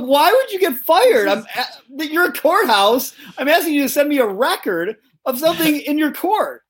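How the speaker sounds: high-pitched exasperated questioning; incredulous outrage. Retold or imitated. Retelling his outraged questions to the clerk, high and incredulous. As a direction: plead in disbelief.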